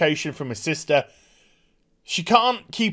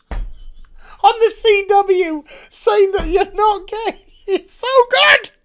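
high squeal through real laughter; helpless laughter; 13 semitones higher, 5 dB louder, slower than usual. Real, not acted. Reviewer delighted by the line, helpless laughter. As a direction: gasp it out while cracking up.

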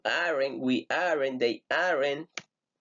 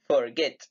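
"Forget" stressed on its second syllable, GET. Shown as a demonstration. Teacher modelling the verb for students, stress on GET. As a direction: put the force on GET. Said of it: "Get, en la segunda sílaba".

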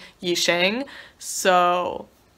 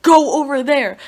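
louder, higher imitation of a friend yelling; retold scream; 6 semitones higher, 8 dB louder than usual. Friend ordering her across the venue, imitated scream. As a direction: yell it like an urgent order, retold. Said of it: "Erin screamed at me".

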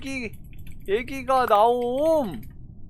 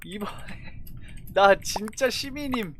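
ordinary talk

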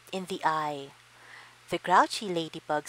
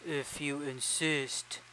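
low slow gorilla voice; 3 semitones lower, 6 dB quieter, slower than usual. Acted. Gorilla accepts fight.

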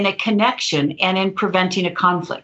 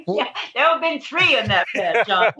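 laughing; 5 semitones higher than usual. Real, not acted. Laughing at host's joke.

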